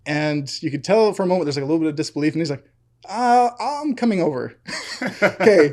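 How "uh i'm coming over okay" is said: laughing quote. Retold or imitated. Dennis' reply, laughing.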